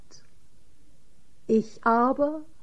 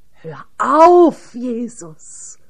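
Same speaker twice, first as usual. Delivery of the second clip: a shocked shout that drops away on the name; horrified cry; 7 dB louder, much slower than usual. Acted. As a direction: cry out in horror to stop him. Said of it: "rief Johannes entsetzt".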